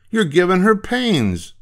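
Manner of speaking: put-on high-pitched boy objecting; protesting schoolboy. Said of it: "said Butch".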